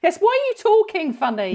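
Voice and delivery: high-pitched mocking question; mock challenge. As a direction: tease someone for talking funny. Acts reply to returning friend.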